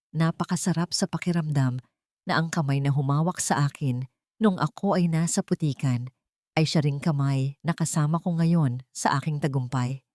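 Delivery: ordinary talk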